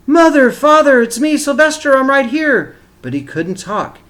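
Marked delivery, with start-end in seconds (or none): 0.1-2.7 loud high calling out to his parents; a boy crying out to his parents who cannot hear; wished-for shout. Acted. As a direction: shout it as if desperate to be heard. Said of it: "How he wanted to shout".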